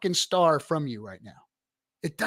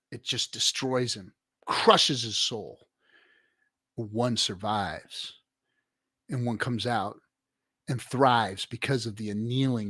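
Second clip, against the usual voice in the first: ordinary delivery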